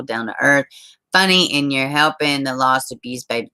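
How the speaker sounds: usual speaking voice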